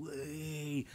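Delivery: drawn-out retold whee; mock game-show entrance cheer, held back in volume; softened playful whoop. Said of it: "thinking like a game show".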